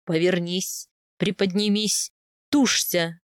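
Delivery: low, firm spaced-out orders; steady commanding male character; husband's commands. A narrator's reading. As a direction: give short orders to a labouring wife. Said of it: "слышались команды Аяза".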